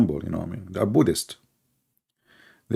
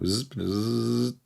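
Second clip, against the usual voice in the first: peeing noise; 2 semitones higher, 6 dB quieter than usual. Retold or imitated. Imitating a dog peeing.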